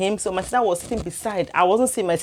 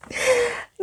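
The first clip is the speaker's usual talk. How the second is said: a short high burst of laughter; high giggle. Real, not acted. Laughing to viewers about her son's reaction.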